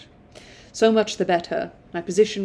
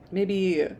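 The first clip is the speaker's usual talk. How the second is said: ordinary delivery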